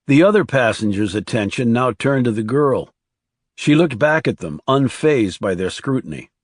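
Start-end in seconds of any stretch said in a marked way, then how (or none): none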